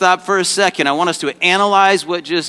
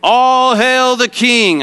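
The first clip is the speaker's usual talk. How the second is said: long, high royal acclamation; audience cue; 8 semitones higher, 5 dB louder, slower than usual. Shown as a demonstration. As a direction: proclaim it high and slow for the crowd to echo. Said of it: "I want everybody in the audience to say".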